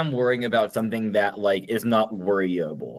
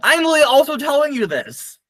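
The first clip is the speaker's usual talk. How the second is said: high-pitched protest; 4 dB louder than usual. Real, not acted. Laughing protest after the song.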